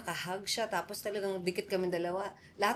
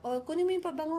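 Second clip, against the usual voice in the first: mock shouting crowd; 9 semitones higher, faster than usual. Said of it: "may mga nagsisigawan daw".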